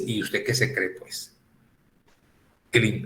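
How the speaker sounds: usual speaking voice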